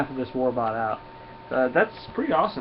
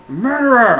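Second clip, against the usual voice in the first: screamed accusation, much higher and louder; furious shout; 11 semitones higher, 13 dB louder, slower than usual. Acted. Bystander screaming murderer at the captain. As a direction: scream it at the killer.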